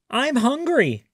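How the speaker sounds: high, loud, sudden perk-up. A narrator's reading. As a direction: blurt it out all of a sudden. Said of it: "Gilbert said suddenly".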